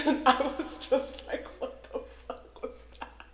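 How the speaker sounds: high-pitched words dissolving into breathless laughter; laughing. Real, not acted. Storyteller recalling their own baffled reaction, laughing. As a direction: start loud through a laugh, then run out of voice.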